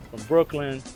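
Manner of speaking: usual speaking voice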